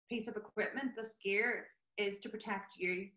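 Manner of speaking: normal talking voice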